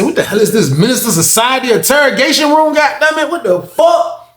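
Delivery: high-pitched, disbelieving protest; incredulous outburst. Retold or imitated. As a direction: blurt it out high, stretching the last words. Imagined outburst at interrogation room.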